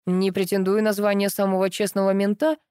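ordinary delivery

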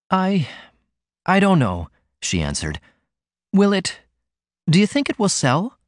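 put-on unsure young lady picking her words; hesitant young woman. A narrator's reading.

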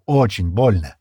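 grave, emphatic warning. A narrator's reading. Стэн warns Шира how much it will hurt, grave emphasis. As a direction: weigh the words to prepare them. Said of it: "предупредил он".

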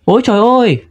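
high-pitched startled cry; narrated yelp of alarm; startled shriek. A narrator's reading. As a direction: cry out in sudden fright, without much volume. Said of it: "giật mình kêu thét lên".

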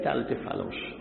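hushed whispered jab; whispered aside. Acted. Preacher whispering to the congregation, mock disgust. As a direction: drop to a whisper for the punchline.

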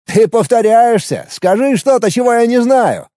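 mocking. A narrator's reading. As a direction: tease him to his face. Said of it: "насмешливо ответил Алекс".

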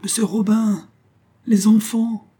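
high, astonished call; overcome exclamation. A narrator's reading. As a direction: call out overwhelmed at seeing friends. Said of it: "bégaya le Parisien".

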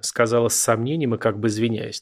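ordinary delivery

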